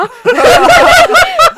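loud falling burst of laughter; big high laugh. Real, not acted. Telling friends the story, big laugh. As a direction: laugh hard at the memory.

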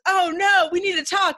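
hammed-up urgent panic; urgent retold plea; mock alarm. Client's alarmed reply retold.

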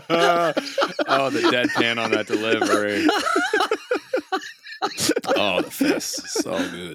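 high-pitched laughing delight; laughing hard. Real, not acted. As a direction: burst out laughing, squealing. Player cracks up at joke.

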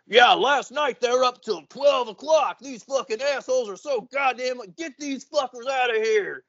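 mock angry neighbours; mock angry locals. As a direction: complain like a fed-up local. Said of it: "nearby locals are like".

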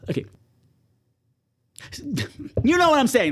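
usual speaking voice

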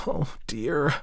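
sighed dismay. A narrator's reading. Daughter gives in to her mother, sighing. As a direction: sigh the words out in dismay. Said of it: "sighed Elsie".

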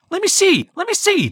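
put-on high eager girl voice; demanding little girl. A narrator's reading. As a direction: insist like an impatient child. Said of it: "demanded Jessica".

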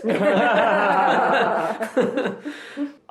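loud high burst of laughter; a hearty laugh at his own joke; big laugh. Real, not acted. Guest laughs at making up a new backstory, loud laughter.

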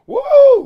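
high, loud "woo"; loud high whoop of excitement; excited whoop. Real, not acted. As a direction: let out a big announcing whoop. Excited about new show format.

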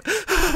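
breathy laughing burst; high giddy laugh. Retold or imitated. Winner overcome at beating the rival, high giddy laugh.

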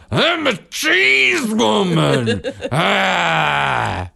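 high, slow, loud hammed-up cheese woman; child playing a goofy cheese woman character; silly cheese woman. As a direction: belt it like a proud cartoon hero. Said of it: "And she goes".

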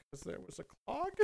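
sheepish mumble. Retold or imitated. Sheepish answer to angry plumber.

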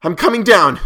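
loud, high rallying shout; resolute shout. A narrator's reading. Narrator shouting down to his trapped friend, resolute. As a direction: shout down to him with determination. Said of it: "I formed and shouted a resolution".

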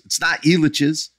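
ordinary talk, nothing marked

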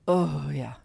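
low, measured mock moaning; in-between lover moan. Shown as a demonstration. Third take for co-host, low mock moaning. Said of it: "I gotta get somewhere in between".

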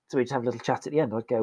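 ordinary delivery

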